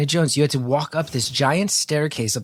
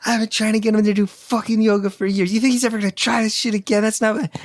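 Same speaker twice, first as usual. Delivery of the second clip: higher, fast angry rant; wife's furious rant; 9 semitones higher than usual. Retold or imitated. Wife yelling at the yoga teacher, higher and heated. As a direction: tear into the instructor, pitched way up. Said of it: "gets in a shouting match".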